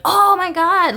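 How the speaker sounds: mock anguished exclaim; exaggerated. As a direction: ham up the dismay. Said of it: "making all these faces".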